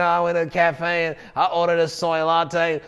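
mock nasal drone on one note; put-on nasal monotone; nasal twang. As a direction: imitate someone who talks through the nose. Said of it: "I don't know why I'm having that sort of an accent".

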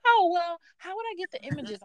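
lightly mocking a helpless coworker. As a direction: play the coworker fishing for help. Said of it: "She's like".

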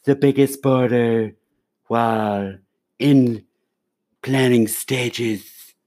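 slow, drawn-out, emphatic Trump voice; Trump impression. Host doing his Trump for the co-host, slow and deliberate. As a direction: stretch each phrase like a rally speech. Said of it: "That's my Trump".

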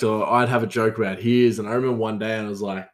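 ordinary talk

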